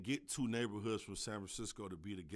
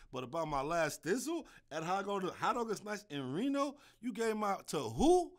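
worked-up rant; 8 semitones higher, 5 dB louder than usual. Real, not acted. Ranting about the lost pill.

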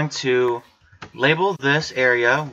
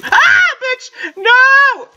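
very high, loud panicked shriek; startled scream; 6 dB louder, much slower than usual. Real, not acted. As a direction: scream at a sudden monster. Monster appears suddenly.

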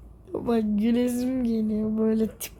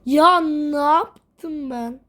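dismayed; 4 semitones higher, 6 dB louder, much slower than usual. Real, not acted. Dismay at own mistake.

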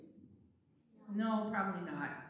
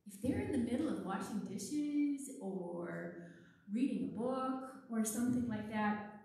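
ordinary delivery

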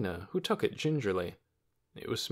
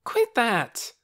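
high, loud objection; indignant cry; 13 semitones higher, 6 dB louder than usual. A narrator's reading. Lena objects to Torin's shove, indignant cry. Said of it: "cried Lena".

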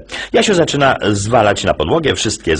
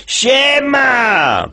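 high cheeky yelled greeting; shouting. Acted. Jasio bursting into class, high shout. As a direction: bellow it up high like a brat. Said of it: "i krzyczy".

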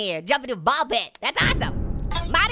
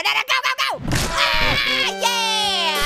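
excited shouting; 6 semitones higher, much slower than usual. Real, not acted. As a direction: yell the character over the line. Desperate final jump in game.

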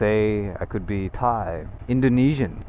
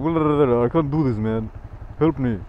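mock French friend babbling and pleading; lightly raised voice in a badly faked French accent; botched French accent; 3 semitones higher than usual. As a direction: imitate a French mate complaining, accent slipping toward Lebanese. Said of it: "French accent".

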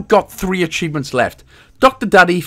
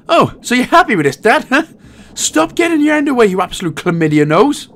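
put-on higher, scolding baby voice; mock scolding voice; 8 semitones higher than usual. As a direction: tell the dad off, high. Babies scolding dad.

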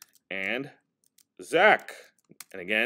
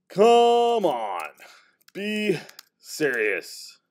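acted Zach line; 7 semitones higher, 5 dB louder, much slower than usual. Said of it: "so Zach's gonna be like".

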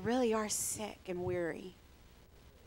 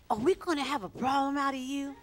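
higher-pitched, pointed interrogation; interrogating; 5 semitones higher, 4 dB louder than usual. Retold or imitated. Pastors confronting a newcomer, stern mock interrogation. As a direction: repeat the challenge harder, imitating tough questioners. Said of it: "That's how they talked to him".

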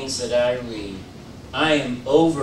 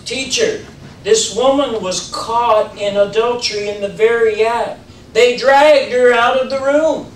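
loud, high, accusing cry to the teacher; angry accusers; 8 semitones higher, 7 dB louder than usual. Acted. Accusers shouting to Jesus about the woman, angry. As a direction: demand judgment, voice climbing.

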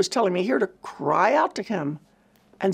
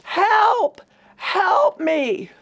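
retold scream for help; 12 semitones higher, 5 dB louder, much slower than usual. Woman in pain at night screaming for her helper, loud high cry. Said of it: "and I screamed".

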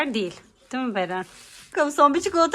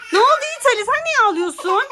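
high, louder concerned call; 10 semitones higher, 4 dB louder than usual. Real, not acted. Calling to crying boy.

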